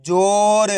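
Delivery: जोर with a long held "o" and light final र; half final letter. Shown as a demonstration. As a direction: draw out जोर for learners, halving the र. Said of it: "last वाले अक्षर का उच्चारण आधा किया जाता है". (जोर को खींचकर, आखिरी र आधा)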